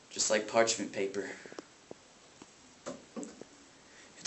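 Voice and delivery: low, soft, halting grief; grieving brother. Acted. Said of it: "who weeps over his sister's casket".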